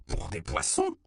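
understated outraged echo; affronted aunt's exclamation; indignant exclamation. A narrator's reading. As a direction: exclaim in mild indignation, voice rising. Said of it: "s'écria tante Carmen".

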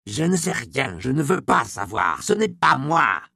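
voix de vieillard paniqué, de plus en plus aiguë et forte; vieillard affolé. Acted. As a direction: refuse en protestant, au bord de la panique. Vieillard terrifié qui refuse de répondre. (le vieil homme terrifié repousse l'apothicaire, d'une voix affolée)

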